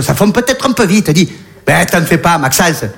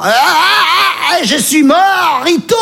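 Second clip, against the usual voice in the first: high laughing shout; 12 semitones higher, much slower than usual. Acted. Comedian dying of laughter at his own joke, high laughing shout.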